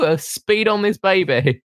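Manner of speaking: ordinary talk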